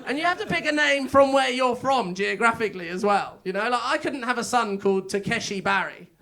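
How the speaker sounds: normal talking voice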